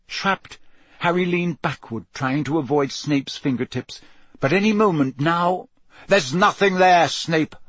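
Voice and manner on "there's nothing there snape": louder, sharp and curt; barked. A narrator's reading. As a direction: bark it at him. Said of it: "barked Moody".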